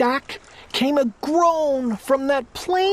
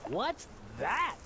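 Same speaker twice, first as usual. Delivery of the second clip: a small far-off startled question, much softer; 4 semitones lower, 15 dB quieter than usual. Acted. Shaken king asks what the burp was, startled. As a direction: ask it faint and startled from above.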